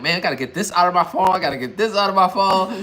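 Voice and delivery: exasperated venting. Retold or imitated. Speaker venting about getting content out of his phone, exasperated. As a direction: vent it loudly, fed up with the clutter.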